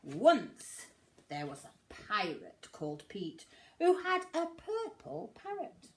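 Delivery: plain reading voice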